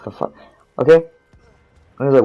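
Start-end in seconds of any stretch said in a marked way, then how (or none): 0.0-0.3 muttered almost to nothing; a near-silent disbelieving aside; under my breath. Retold or imitated. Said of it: "Like, under my breath".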